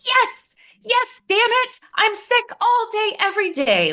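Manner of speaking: high, exasperated mock yell at mom. Daughter snapping at her mom over being sick again, exasperated and high.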